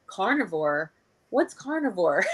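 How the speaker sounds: scoffing question that breaks into a laugh; mock-puzzled, giggling; laughing mock disbelief. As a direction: laugh off the idea as if it were absurd. Mocking carnivores as past vegan.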